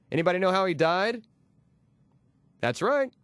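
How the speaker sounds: ordinary talk, nothing marked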